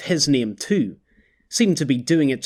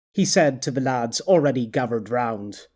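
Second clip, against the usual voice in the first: plain reading voice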